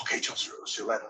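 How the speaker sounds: a soft, whispered goodbye in Pierce's manner; imitating Pierce. Imitating Pierce saying goodbye to him in a hushed voice. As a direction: let it slip out hushed like a line of script. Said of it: "It's like he's reading a script".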